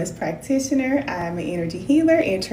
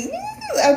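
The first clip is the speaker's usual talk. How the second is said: happy squeal. Retold or imitated. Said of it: "I'm just like".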